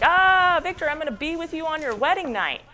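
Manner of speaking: high, loud, hammed-up threat; menacing monster. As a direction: sing out the threat like a cartoon villain.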